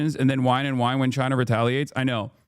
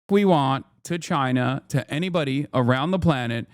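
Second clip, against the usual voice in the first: ordinary talk, nothing marked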